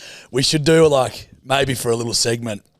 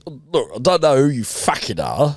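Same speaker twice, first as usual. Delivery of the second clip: swaggering, sweary gangster; Alfie. Acted. Gangster confronting a kidnapper, sweary and swaggering. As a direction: lean on the swear word, pitch swooping.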